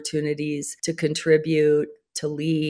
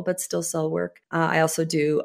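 ordinary talk

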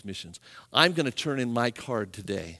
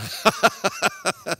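acted burst of laughter; hilarious laugh; 6 semitones higher, 6 dB louder than usual. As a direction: laugh out like a cheerful giver. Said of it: "So he's laughing".

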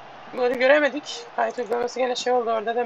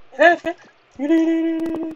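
excited cheering; 6 semitones higher, 5 dB louder, faster than usual. Real, not acted. Cheering a teammate forward.

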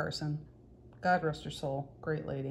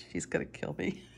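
whispered aside through a laugh; 4 semitones lower, 9 dB quieter than usual. Real, not acted. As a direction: let it slip out as a giggly whisper. Joking aside about friend's price.

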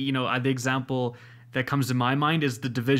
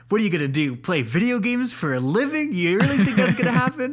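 imitating an incredulous dad, rising high and louder; mock a disbelieving parent, pitch climbing; sceptical dad; 7 semitones higher, 4 dB louder than usual. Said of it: "My dad was like".

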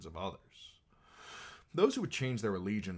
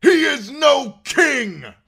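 high, drawn-out shouted denial; defiant cry; 20 dB louder, much slower than usual. A narrator's reading. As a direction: cry it out at full force. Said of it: "he cried".

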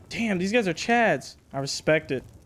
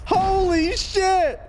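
excited shout; 11 semitones higher, much slower than usual. Real, not acted. Huge C4 raid explodes.

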